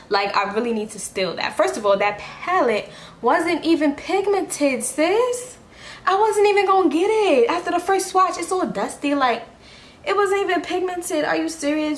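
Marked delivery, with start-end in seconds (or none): 1.6-12.0 high-pitched, indignant rant; a sassy, disbelieving rant. Real, not acted. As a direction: scoff at the cheap palette. Ranting about the palette, pitch up.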